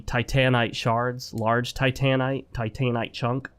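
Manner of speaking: "titanite" said tie-TAN-ite each time; "titanite" with a full, stressed middle syllable; proposed pronunciation. Shown as a demonstration. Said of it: "it's probably supposed to be".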